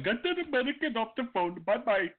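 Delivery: high, far-off put-on Indian accent voice; a smug call agent ringing off, high and bright; mock Indian agent. Call agent gloating after hanging up, in a mock Indian accent.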